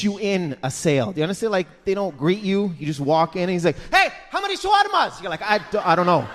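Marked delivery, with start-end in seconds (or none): 3.9-5.2 high-pitched, abrupt pushy shout; yelling shawarma seller. Acted. Shawarma seller to new customer, yelling. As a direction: yell it like a pushy vendor. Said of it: "Why are you yelling at me".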